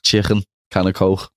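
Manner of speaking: "Coke" ending in a throaty kh; Scouse chicken, can of Coke. Shown as a demonstration. Scouser repeats his party-piece phrase: Scouse accent. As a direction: Liverpool sounds laid on for show. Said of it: "everyone always gets us to say".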